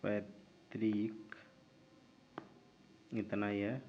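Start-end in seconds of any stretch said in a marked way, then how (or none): 0.0-1.4 पैतृक stretched slowly and softly; slow dictation. Shown as a demonstration. Teacher quietly dictating पैतृक while writing thinner. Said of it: "इसको थोड़ा सा पतला करते हैं".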